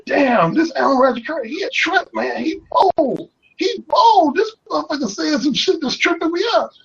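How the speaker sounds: high-pitched imitation of an astonished man; amazed husband. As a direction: act out a husband marveling to his wife. Acts amazed husband talking to wife.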